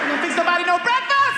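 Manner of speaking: high, loud taunt; defiant yelling. Retold or imitated. Son to Mama in church, defiant high yell. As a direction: holler it in mock outrage. Son keeps back-talking to mother.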